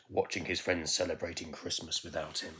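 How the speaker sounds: usual speaking voice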